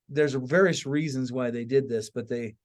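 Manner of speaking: normal talking voice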